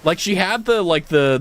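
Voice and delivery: ordinary delivery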